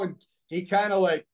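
ordinary delivery

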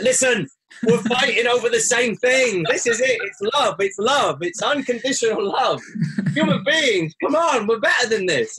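mock yell at the world's faiths to stop fighting; retold shouting. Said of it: "I'd been screaming and shouting".

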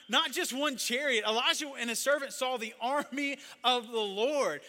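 loud, high, impassioned sermon voice; full-force preaching. Acted. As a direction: proclaim it with mounting conviction to a congregation.

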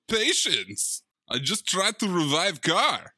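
mock Eastern European accent, "car" dropped; broken English accent. Host imitating Travolta's Balkan accent to De Niro. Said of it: "that broken English".

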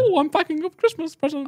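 high, put-on comic voice; put-on joke voice. Replaying an old job for laughs, put-on voice. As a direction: do a high-pitched silly character. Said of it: "put on a voice".

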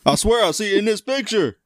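high-pitched mock Southern vow; light Southern accent, teasing. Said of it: "giving a Southern accent to a Pennsylvania guy".

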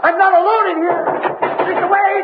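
frightened calling out. Acted. Trapped man shouting for Wade, panicked. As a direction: yell for Wade, terrified and trapped.

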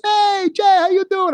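very high, cheery Italian voice; mock Italian greeting. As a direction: greet him brightly with an Italian lilt. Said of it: "he was Italian".

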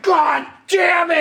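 high, slow, loud mock curse; imitating a furious friend cursing; storming off. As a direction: explode in frustration. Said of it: "Jeff storms into the kitchen".

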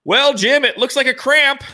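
high mock commentator voice; Tony Romo impression. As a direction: imitate the announcer's casual call. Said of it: "Tony Romo being like".

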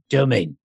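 "Do you mean" squashed into two syllables, "Joe mean"; Jason Statham. Shown as a demonstration. Teaching a Statham key, "Do you mean" slurred into "Joe mean". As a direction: run "Do you mean" together fast as "Joe mean". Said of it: "he's reduced six words to two syllables".

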